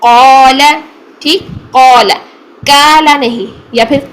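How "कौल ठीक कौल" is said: कौल full and loud; heavy qaul. Shown as a demonstration. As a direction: stress कौल heavy throughout. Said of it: "मश्क देखते हैं". (मोटा कौल)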